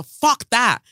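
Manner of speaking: fury that will not be soothed; real outrage. Real, not acted. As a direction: burst out in anger, then stress the deaths. Outrage at spiritual excuses for deaths.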